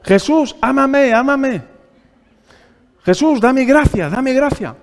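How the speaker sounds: high, loud pleading, hammed-up; mock begging. Mock beggar pleads to Jesus for love, high and loud. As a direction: beg Jesus like a needy child. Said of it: "no le dijo".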